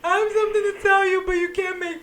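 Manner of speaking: high, tearful pleading; mock hysterical. Tearful call to her sister, retold as mock hysteria. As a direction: plead in a high, put-on crying voice. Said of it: "I'm hysterical now".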